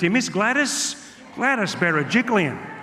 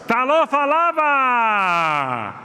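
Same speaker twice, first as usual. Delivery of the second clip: high, drawn-out call to the crowd; rousing greeting; 7 semitones higher, 3 dB louder, much slower than usual. Real, not acted. Comic hailing his Islander friends in the audience, rousing greeting. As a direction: hail them with a long, falling cry.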